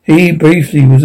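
usual speaking voice

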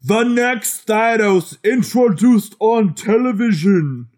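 slow, loud, high announcer boom; shouting capitalized words. Acted. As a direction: proclaim it like a movie trailer. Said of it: "And then in bold".

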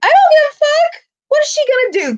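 high, loud defiance; defiant outburst. Real, not acted. Fan daring staff to kick her out, defiant. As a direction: fire it off high and indignant.

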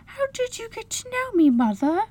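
a mother tenderly quoting her small son; little boy. A narrator's reading. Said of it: "Michael suddenly saying to me".

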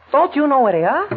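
sudden high alarmed cry; startled fear at the gun; panicked blurt. Acted. Shorty alarmed at gunman.

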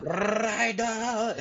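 "Ryder" high, with a long, hard trilled R; angry rolled R. Retold or imitated. Mad controller calling the pilots, "Ryder" with hard rolled R. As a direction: roll the R hard on "Ryder", imitating fury. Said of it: "he rolled his R's even harder".

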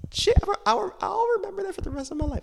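very high, fast squeaky voice; mock dramatic squeal. Retelling her own thought to viewers in a high squeak.

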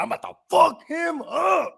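high, slow, drawn-out mock shout; mock threat. Imitating Killer Clowns eyeing his team, drawn-out mock threat.

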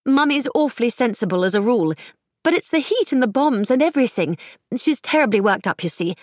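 put-on fretful society girl, clipped upper-class English; clipped upper-class English accent. A narrator's reading. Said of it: "the girl's clipped accent".

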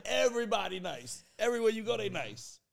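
usual speaking voice

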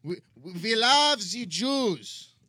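slow, loud, hammed-up German; angry German accent. Host voices the rebranded party, angry German accent. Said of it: "Give me your best German accent".

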